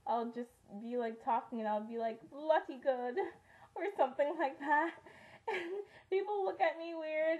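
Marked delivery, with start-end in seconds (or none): 2.3-3.2 playful imitation of a British accent. Harry Potter fan slipping into an accidental British accent. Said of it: "come out in a British accent". 3.5-7.4 higher, giggly talk through laughter; real laughing. Real, not acted. Vlogger embarrassed by her British accent slips, laughing.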